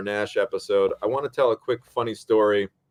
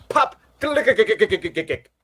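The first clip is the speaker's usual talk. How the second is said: clicking noises imitated, pitched higher; imitating neck clicks; 9 semitones higher, 4 dB louder than usual. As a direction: mimic the cracking of the neck. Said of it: "you could literally hear a massive pop".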